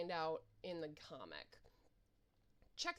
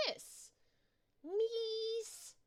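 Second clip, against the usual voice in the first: high squeaky worm crowing; 13 semitones higher, 4 dB louder than usual. Acted. Bandage worm gloats at the player, high and squeaky. Worm taunts after dodging.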